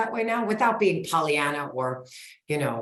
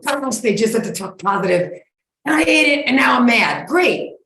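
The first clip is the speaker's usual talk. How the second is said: louder and higher, hammed-up annoyance; mock frustrated rant; 5 semitones higher, 6 dB louder than usual. Listener venting after eating sugar, mock mad. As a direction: play the annoyed dieter who ate sugar.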